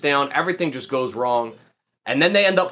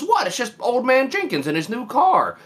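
high, put-on cartoon voice; Mister Krabs' mom; 9 semitones higher than usual. Friend imitating cartoon mother excusing herself, high put-on voice. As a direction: pitch it way up, protesting innocence. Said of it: "Mister Krabs' mom".

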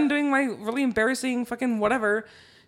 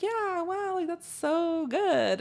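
high, soft, stretched-out praise; feigned polite praise; 6 semitones higher, 7 dB quieter, much slower than usual. Retold or imitated. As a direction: imitate her humoring a bad player, slow and high. Retold polite praise of bad playing.

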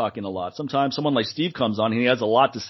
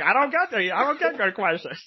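higher, hammed-up impression of the doctor; Doctor Gordon; 8 semitones higher than usual. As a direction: exaggerate the guest doctor's voice for laughs. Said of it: "why did you automatically go into Doctor Gordon voice".

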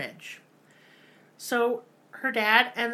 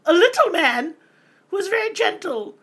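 high mock voice; 10 semitones higher, 10 dB louder than usual. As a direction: jump way up and loud for little man. Mocking the gentle father.

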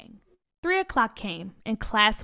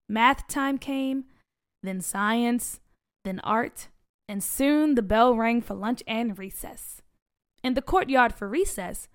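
ordinary delivery, nothing marked

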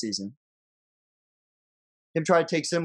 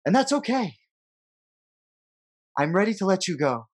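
dramatic poem reading. Acted. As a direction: read it like a farewell letter. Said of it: "You wanna read the poem for us".

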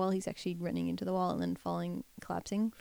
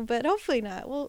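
normal talking voice

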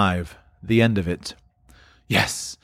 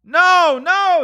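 high, loud cries; insistent calling; 8 dB louder than usual. Acted. Scrooge insisting to the boy, high loud call.

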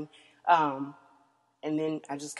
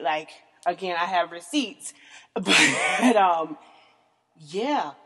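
normal talking voice

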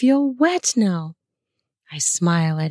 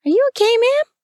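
very high, louder cashier voice; 4 dB louder, faster than usual. A narrator's reading. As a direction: pitch it way up as a concerned cashier. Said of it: "The cashier asks".

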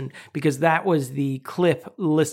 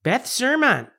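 hammed-up Chicago accent, pitched up; 6 semitones higher than usual. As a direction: announce it as a mock Chicagoan. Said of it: "we haven't done a single Chicago accent".

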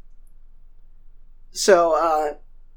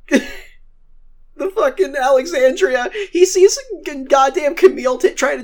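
laughing through words; 6 semitones higher, slower than usual. Real, not acted. Laughing while recapping scene.

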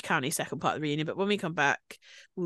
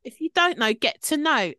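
playful catchphrase; 6 semitones higher, 5 dB louder than usual. Real, not acted. Repeating catchphrase playfully higher.

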